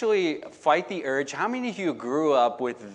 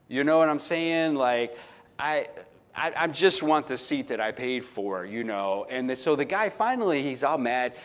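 ordinary talk

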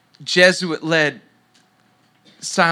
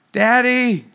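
put-on a higher, louder child's call, more insistent now; daughter calling again; 7 semitones higher, 4 dB louder than usual. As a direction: call out for daddy again, a bit more urgently. Daughter calls again, night.

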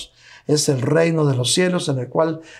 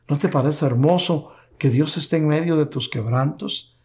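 ordinary talk, nothing marked

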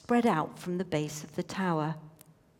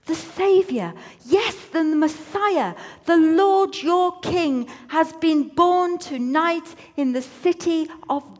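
proclaiming; 8 semitones higher, 6 dB louder than usual. Acted. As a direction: herald it to the shepherds. Angel proclaiming the birth.

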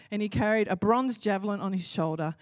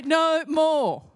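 defiant no more; 6 semitones higher, 5 dB louder than usual. Acted. Declaring stand against intimidation.

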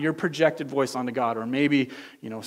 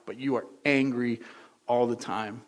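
ordinary delivery

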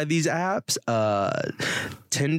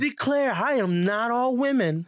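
Southern belle; 9 semitones higher than usual. Pretend. Mock Southern belle protest.

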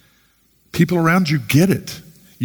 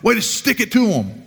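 high, sing-song mock amen cheering; hammed-up congregational enthusiasm; mock approval; 8 semitones higher than usual. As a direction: cheer her on sarcastically, pitched way up. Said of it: "She just assumed that I would be like".

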